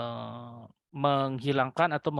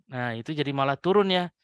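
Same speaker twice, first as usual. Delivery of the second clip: ordinary talk, nothing marked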